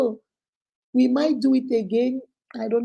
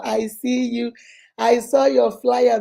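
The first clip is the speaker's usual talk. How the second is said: ordinary delivery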